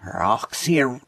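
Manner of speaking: reversed audio clip; words played in reverse; played backwards. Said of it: "I'll play 'em again".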